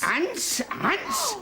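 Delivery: rising from high to very high squeak; horrified disbelief. Acted. Husband learning the bugs are ants, horrified disbelief. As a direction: repeat it in mounting horror.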